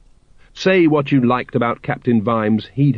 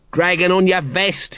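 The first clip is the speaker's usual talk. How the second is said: mild shout; 8 semitones higher than usual. A narrator's reading. Nobby jeers at the departing guards, calling out. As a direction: call it after him tauntingly. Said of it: "he shouted".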